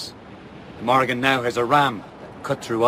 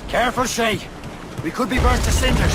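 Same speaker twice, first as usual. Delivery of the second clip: alarmed warning; 8 semitones higher than usual. Acted. Warning amid burning sea.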